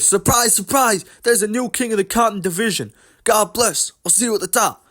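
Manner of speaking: high mock promo voice; hyped fighter promo. Host mocking a fighter's victory promo, high put-on voice. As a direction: put on a fighter's big catchphrase delivery.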